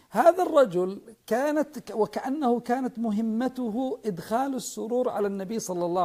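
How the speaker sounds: rising passionate oratory; fiery preaching. Real, not acted. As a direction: preach it with full conviction. Impassioned storytelling in sermon.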